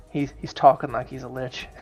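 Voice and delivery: ordinary delivery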